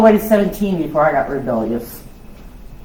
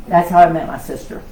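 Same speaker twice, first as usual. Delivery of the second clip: laughing punchline. Real, not acted. Storyteller telling the crowd how she met her sister, laughing. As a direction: laugh as you land the punchline.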